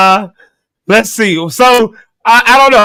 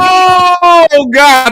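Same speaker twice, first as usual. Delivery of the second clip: loud exclamation; 12 semitones higher, 5 dB louder than usual. Real, not acted. Shouting after a big laugh.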